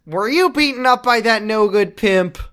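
high, lightly put-on starstruck nurse; mock nurse. ER nurse greeting the famous Jack, high mock fawning voice. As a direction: gush at him like a fan at the front desk.

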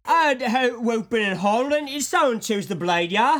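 loud, high hammed-up Cockney accent; mock Cockney. Co-host joins the Cockney guard bit, hammed-up Cockney. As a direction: join in with a silly Cockney bloke. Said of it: "Cockney man".

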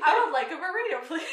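loud and giggling; laughing. Real, not acted. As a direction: blurt it while laughing. Joining the laughter.

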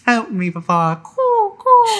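high, squeaky mock baby; little child voice. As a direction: coo like a helpless tot. Mimics the helpless child.

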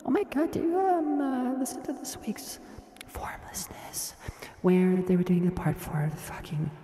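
put-on high, airy excited girl voice; breathy girl voice. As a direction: gush like a smitten fan girl. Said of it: "the character that I'm playing right now".

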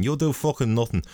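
mock fighter's taunt in a Russian accent; teasing Russian trash talk. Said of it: "We were both doing the accents".

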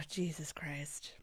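slow exasperated whisper. Real, not acted. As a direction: breathe it out, fed up. Exasperated at romance novel.

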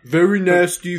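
high, loud hammy Trump; bad Trump impression. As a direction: ham up Trump saying it. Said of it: "impression of a bad impression".

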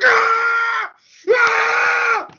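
high-pitched sustained shriek of shock; screaming. Retold or imitated. Moviegoer reliving the twist in the theater, screaming. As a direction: scream it out in two long bursts. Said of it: "Just absolutely screaming in the theater".

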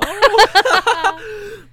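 squealing high laugh; high burst of laughter. Real, not acted. Laughing at mulch question.

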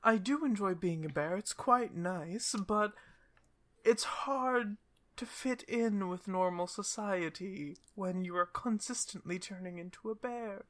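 high, gentle voice of a sad cursed princess; sad cursed princess bear. Acted. Bear-princess tells the party her sad story, in a high gentle voice. Cinderella answers Briar.

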